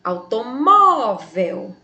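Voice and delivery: "Automóvel" slow, with a long, swelling "mó"; stress on penultimate syllable. Shown as a demonstration. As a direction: stretch "mó" in "Automóvel". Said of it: "penúltima sílaba tônica". ("Automóvel" devagar, com "mó" forte e alongado)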